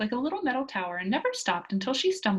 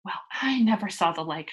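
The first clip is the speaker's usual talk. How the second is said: ordinary delivery, nothing marked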